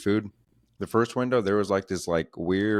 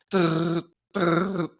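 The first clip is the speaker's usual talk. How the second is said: much higher, goofy mock-dumb durr; dumb durr noises; 10 semitones higher, much slower than usual. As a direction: play yourself as a brainless, panicked comic. Mocking himself freezing on stage.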